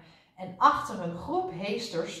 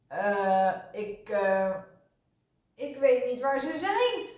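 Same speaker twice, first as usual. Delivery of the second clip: flustered, halting nurse; stammering; 5 semitones higher, 3 dB louder, slower than usual. A narrator's reading. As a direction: hesitate with filler sounds, then rise in pitch. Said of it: "hakelde zuster Snijwiet".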